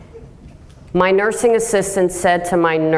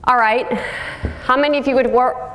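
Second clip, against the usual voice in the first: usual speaking voice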